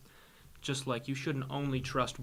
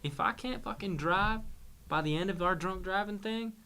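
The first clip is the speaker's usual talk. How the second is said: put-on higher, excited Texan drunk; Texas accent; 6 semitones higher, 4 dB louder than usual. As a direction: pitch it up like a rowdy Texan. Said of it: "He says".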